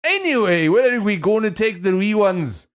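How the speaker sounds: put-on high, loud failed Scottish accent; hammed-up fake Scottish parent; bad Scottish accent. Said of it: "Can't do Scottish".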